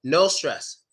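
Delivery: ordinary talk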